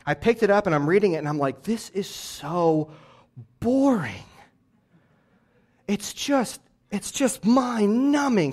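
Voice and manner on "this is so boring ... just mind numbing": drawn-out, bored complaint; bored whine. Retold or imitated. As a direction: drag the words like a bored kid. Bored reading as a kid.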